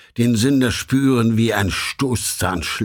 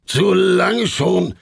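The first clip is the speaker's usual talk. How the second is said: high, loud and slow shout; impatient shout; 8 semitones higher, 3 dB louder, much slower than usual. Acted. The red devil shouts at the sinner, high and drawn out. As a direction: drag it out, fed up. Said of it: "rief da der Feuerrot".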